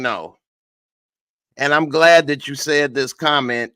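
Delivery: ordinary delivery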